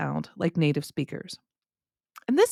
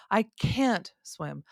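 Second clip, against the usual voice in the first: slow, stretched "can't", then a falling "swim"; stress on CAN'T; much slower than usual. Shown as a demonstration. Teacher shows lack of ability to swim, stress on "can't". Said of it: "the emphasis is on the can't".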